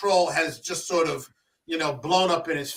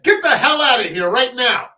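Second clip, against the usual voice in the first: higher and louder, pointing him out the door; angry order; 7 semitones higher, 9 dB louder than usual. Retold or imitated. Mentor ordering the man out of his own house, angry. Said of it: "he pointed and said".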